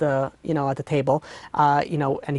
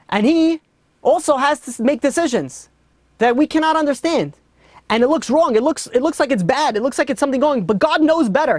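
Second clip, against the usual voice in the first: impassioned preaching; 7 semitones higher than usual. Acted. Rabbi to grieving judge, impassioned higher preaching. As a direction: lift it up and preach it.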